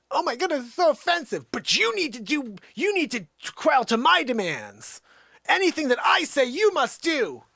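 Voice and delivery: high, loud, hammed-up outrage; mock offended diva. Host mocking offended people online in a high, loud, hammed-up voice. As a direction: play a shrill entitled snowflake making demands.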